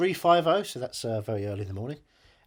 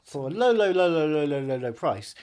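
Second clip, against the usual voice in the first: ad voice; 4 dB louder than usual. Pretend. Mock advert price pitch.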